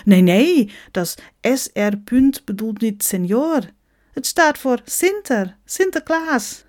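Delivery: put-on high, emphatic Dutch-accented correction. Sinterklaas corrects a teaser, Dutch accent.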